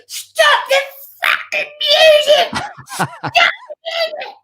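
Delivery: very high, crying shriek imitating the bride; tearful screech. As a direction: wail it out like a hysterical bride. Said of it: "she's at the front in streaming tears".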